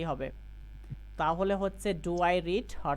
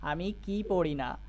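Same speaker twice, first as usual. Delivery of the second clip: slow, measured line with a long কি; emphatic কি, falling to the end; stress on কি. Shown as a demonstration. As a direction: put the weight on কি. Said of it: "কি শব্দের উপরে জোর দিয়ে বলবো".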